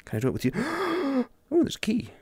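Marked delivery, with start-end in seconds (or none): 0.6-1.2 high, sharp intake of breath; sudden high gasp. Real, not acted. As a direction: gasp on spotting something good. Delighted discovery of a key.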